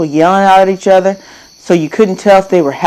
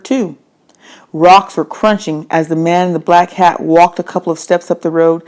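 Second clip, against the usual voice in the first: ordinary delivery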